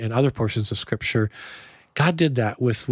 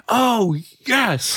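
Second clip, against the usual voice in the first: excited; 10 semitones higher than usual. Retold or imitated. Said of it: "I'm like".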